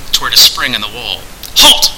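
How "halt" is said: loud barked halt; sharp cry. A narrator's reading. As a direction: stop him dead with one word. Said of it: "cried Turgeon".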